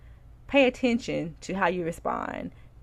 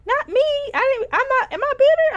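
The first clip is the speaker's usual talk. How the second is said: high, loud incredulous squeal; shocked disbelief; 7 dB louder than usual. Retold or imitated. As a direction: retell the shock of being called bitter. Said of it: "And I was like".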